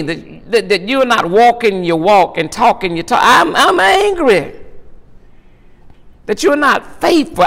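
whooping preacher climb voicing an angry God; full-force preaching. Preacher voicing God's anger.

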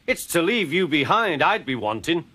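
high, cross grumbling; angry. A narrator's reading. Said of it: "was angry".

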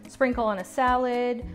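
usual speaking voice

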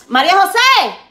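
high, loud call across the room. Real, not acted. Cook calling María José to the food, high loud holler. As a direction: holler the name to fetch someone.

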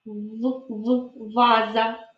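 "В, в" as short v sounds, then "ваза"; isolated first sound. Shown as a demonstration. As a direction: repeat the v alone before saying "ваза". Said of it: "Перший звук В".

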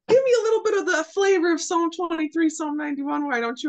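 lightly imitated stranger, starting high and settling; Atlanta garbage man. Garbage man requesting a psalm from a stranger, playful imitation. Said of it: "And he's like".

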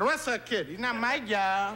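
put-on slow, lazy Puerto Rican accent; Puerto Rican cop.

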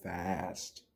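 lively storybook read, big rises and falls; reading to children. A narrator's reading. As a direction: read it like story time for little kids. Teacher reads Seuss book aloud.

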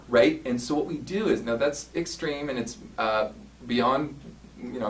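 usual speaking voice

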